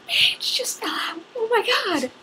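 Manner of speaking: high, loud panicked gasp; real alarm over a torn book; shocked. Real, not acted. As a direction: react as the page drops out. Book page falls out.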